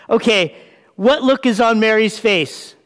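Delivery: normal talking voice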